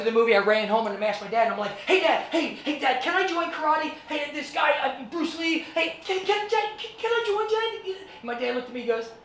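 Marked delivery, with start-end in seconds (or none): 1.8-8.0 put-on high, eager little-kid voice pleading; excited kid begging. Boy pleading with his dad to join karate, high and eager. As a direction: beg like a thirteen-year-old who can't wait.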